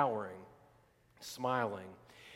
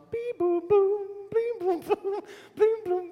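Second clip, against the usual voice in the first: high sung-out bubble noises; cartoon sparkle noises; mock magic sounds; 13 semitones higher, 4 dB louder, slower than usual. Sound effects of Glinda's bubble.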